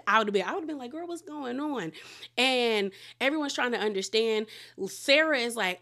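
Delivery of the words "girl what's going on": high, softened pretend bewilderment; bewildered hush. Imagining herself watching the fight, high hushed puzzlement. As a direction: act out a baffled aside, high and soft.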